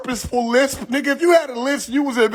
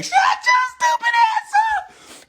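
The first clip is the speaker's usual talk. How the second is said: shrieked high-pitched put-down; high mock shout. Comic telling off rappers, high mock shout. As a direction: yell it way up high in mock outrage.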